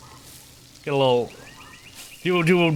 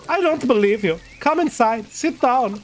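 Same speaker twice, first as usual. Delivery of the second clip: put-on German accent for Klaus; 7 semitones higher than usual. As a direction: say it as a German character inviting someone in. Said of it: "I was doing a fucking German voice for".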